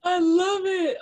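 high, loud drawn-out delight; delighted gushing. Real, not acted. Host reacting to the magazine story, delighted. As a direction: gush it out, real delight.

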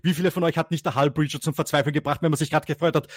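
ordinary talk, nothing marked

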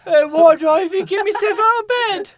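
hammed-up British lament, mocking; mock mopey Brit. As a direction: parody of the sad singer, mock British. Mocking the song's gloom.

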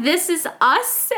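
slow sweet sign reading. Retold or imitated. To her co-host, slow gushing read of the sign. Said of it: "It says".

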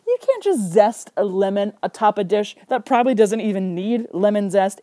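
ordinary delivery

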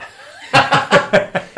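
high, loud burst of laughter. Real, not acted. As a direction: crack up out loud. Big laugh at a joke.

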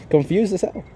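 ordinary talk, nothing marked

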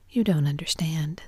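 ordinary delivery, nothing marked

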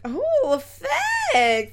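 high, loud, flustered thanks; startled thanks. Retold or imitated. Teacher answering the student's shouted compliment, surprised and high. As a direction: squeak it out, caught off guard. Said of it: "And I was like".

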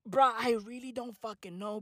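ordinary delivery

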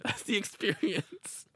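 amused, giggly punchline; slow laughing delivery. Real, not acted. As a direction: savour it while laughing. Laughing about mascot.

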